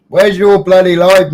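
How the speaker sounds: high, loud teasing call-out; mock outrage. Real, not acted. Streamer teasing a regular viewer, loud mock outrage. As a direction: demand it in playful outrage.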